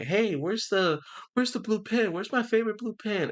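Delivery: high mock husband voice; squeaky voice hunting for his pen; mock husband asking for his favorite blue pen. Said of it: "the husband was like".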